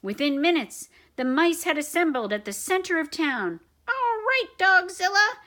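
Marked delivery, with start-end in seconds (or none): 3.9-5.4 high, loud mouse challenge; squeaky shout. Acted. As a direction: shout it like a brave tiny officer. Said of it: "shouted the Big Cheese".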